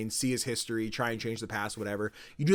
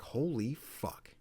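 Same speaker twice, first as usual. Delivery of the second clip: slow, hushed retold shock; stunned disbelief; 10 dB quieter, much slower than usual. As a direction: draw it out softly, as if stunned. Retold stunned reaction to killing.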